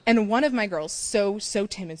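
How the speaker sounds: ordinary talk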